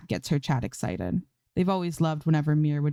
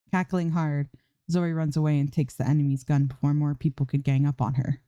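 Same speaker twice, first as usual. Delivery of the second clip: ordinary delivery, nothing marked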